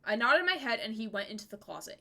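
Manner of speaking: ordinary talk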